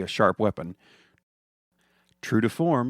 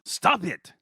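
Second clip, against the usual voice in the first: angry shout; 10 semitones higher, 4 dB louder than usual. Acted. Gargamel yells.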